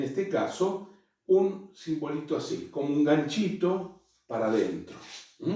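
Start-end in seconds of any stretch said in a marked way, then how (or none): none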